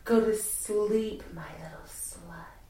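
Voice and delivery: soft whisper into the ear; right in your ear. Acted. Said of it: "whispering into your ear".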